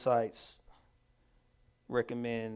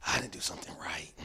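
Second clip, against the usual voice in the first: whispered thought; 7 semitones lower than usual. Retold or imitated. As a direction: whisper it to yourself, crushed. Inner thought after being scolded.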